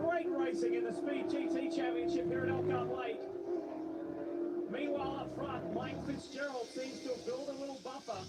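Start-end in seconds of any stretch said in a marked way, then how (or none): 0.0-7.2 motorsport commentator. Real, not acted. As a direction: lively race play-by-play. Live race play-by-play.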